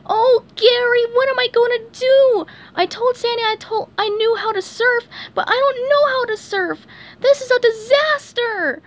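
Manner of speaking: high, loud panicked wail; panicked crying out. Acted. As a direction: fall apart in front of the snail. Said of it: "SpongeBob cried".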